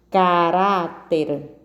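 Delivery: "Caráter" slow, ca-RÁ-ter; slow sounded-out "Caráter", stress on RÁ; stressed middle syllable. Shown as a demonstration. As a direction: stretch "Caráter" out, holding the RÁ. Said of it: "a sílaba tônica é orá".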